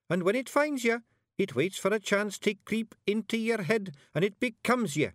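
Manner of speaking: very high Scottish storyteller, measured pace; earnest Scots bard. A narrator's reading. Little bard warns witch about hiver, earnest. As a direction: tell the dark lore in piping Scots. Voices Billy's eerie explanation.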